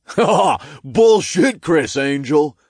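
high, loud, laughing imp; jeering imp. A narrator's reading. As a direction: heckle with a cackle.

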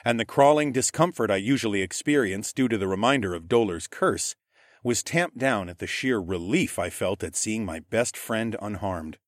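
ordinary delivery, nothing marked

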